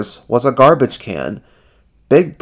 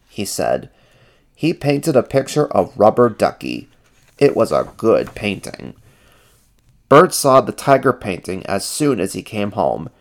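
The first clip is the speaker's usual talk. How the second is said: usual speaking voice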